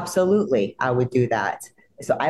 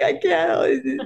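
high, helpless wail; can't stop crying; tearful protest. Retold or imitated. Actress answering her sister about her makeup, wailing tearfully.

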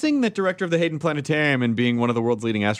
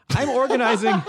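laughing; 10 semitones higher than usual. Real, not acted. Laughing while reading comment.

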